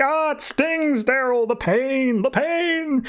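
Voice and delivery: very high, drawn-out comic wail; mock pained yelling. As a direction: cry out in hammed-up agony, words stretched. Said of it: "he's yelling out stuff of like".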